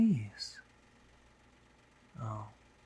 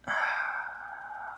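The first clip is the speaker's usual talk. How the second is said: long, breathy sigh; resigned sigh. Acted. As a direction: sigh heavily in mock surrender. Giving in to wife's game.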